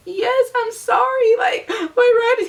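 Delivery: high, slow, near-crying apology; imitating herself crying out an apology; tearful panic. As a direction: plead sorry through a mock sob.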